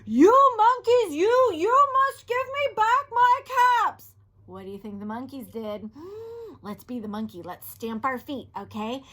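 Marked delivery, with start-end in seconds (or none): 0.1-4.1 high, loud, furious shout. Acted. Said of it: "He stamped both his feet and shouted". 5.9-6.6 sharp higher gasp; surprised gasp. Real, not acted.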